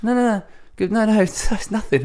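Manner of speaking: high, hurried put-on voice; mock awkward denial. Daughter brushing him off in a high, nervous mock voice. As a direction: wave it away nervously.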